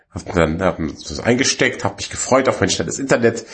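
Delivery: ordinary talk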